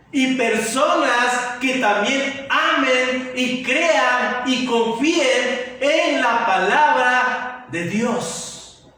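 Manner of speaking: ordinary talk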